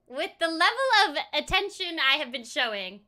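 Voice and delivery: ordinary talk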